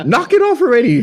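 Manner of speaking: hammed-up exasperated mom; mom fed up. Mom to crying son, high mock scold. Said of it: "she's like".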